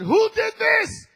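slow, high, loud demanding shout; Saul's shout. Acted. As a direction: bellow it as the angry king. Saul shouting in story.

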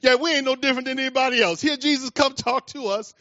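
ordinary talk, nothing marked

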